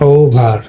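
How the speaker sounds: ও ভার with a long gliding "ou"; phonetic demonstration. Shown as a demonstration. Teacher transcribes the word for students, phonetic demonstration. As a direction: sound out ও ভার as ou-bhar. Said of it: "phonetic ট্রান্সক্রিপশনটা করে দেখাচ্ছি".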